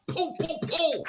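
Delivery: high mouth gunfire pops; gunshot sounds. Retold or imitated. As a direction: pop off mock gunshots. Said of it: "Three gunshots for".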